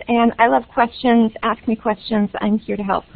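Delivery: normal talking voice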